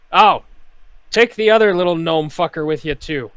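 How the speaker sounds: loud, high barked command; bosun shouting orders. Acted. Bosun ordering Awen to swab the decks, loud shout. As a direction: snap the order at the deckhand. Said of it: "bosun continues".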